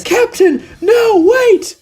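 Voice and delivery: very high, urgent cry; alarmed plea. Acted. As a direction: call out desperately to stop him. Kurotsuchi pleads to stop the captain.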